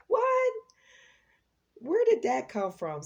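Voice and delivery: high, loud burst of surprise; startled disbelief. Real, not acted. Reader caught off guard by her own rhyme, startled. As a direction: exclaim it, really taken aback.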